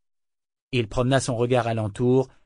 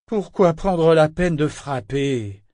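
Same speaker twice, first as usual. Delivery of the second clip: slow, bitter, a touch louder and higher; 5 semitones higher, 5 dB louder, slower than usual. A narrator's reading. Sulking governor to Malta at his door, bitter. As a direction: let each word drip with resentment. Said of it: "répondit-il avec amertume".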